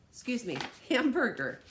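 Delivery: a real laugh at her own mix-up; laughing self-correction. Real, not acted. Teacher laughing as she corrects hot dog to hamburger.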